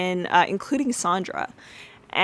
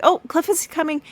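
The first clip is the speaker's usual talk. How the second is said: urgent warning; 9 semitones higher, 6 dB louder than usual. Retold or imitated. Girls warn Cliff approaching.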